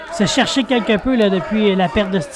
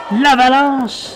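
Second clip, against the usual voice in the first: excited; 3 semitones higher, much slower than usual. Real, not acted. Naming a big move.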